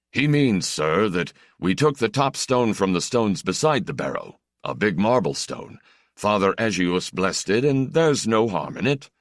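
earnest villager pleading with the stranger. Acted.

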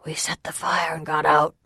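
strained hoarse whisper; exhausted, dazed fugitive. A narrator's reading. Fugitive recounting the tent fire, dazed hoarse whisper. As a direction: force the account out in a whisper. Fugitive tells of his escape.

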